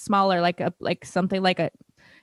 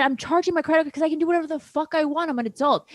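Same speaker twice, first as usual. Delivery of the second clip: higher, heated mock of her own reply; mild mock defiance; retold angry retort; 8 semitones higher than usual. Daughter retelling how she snapped at mom, mildly heated. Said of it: "I said".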